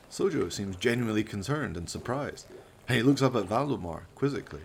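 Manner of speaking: usual speaking voice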